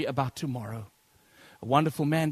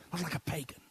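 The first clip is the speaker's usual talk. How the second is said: whisper; 9 dB quieter than usual. Acted. Sudden drop within preached quote.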